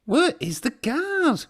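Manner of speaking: high, slow worried call; anxious engine. A narrator's reading. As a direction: call out for the guard. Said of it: "whistled Thomas".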